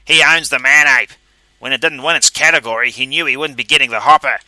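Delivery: loud, high, accusing; angry. A narrator's reading. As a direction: accuse him angrily. Pinky accuses other Calverley.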